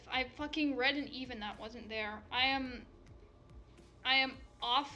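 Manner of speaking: normal talking voice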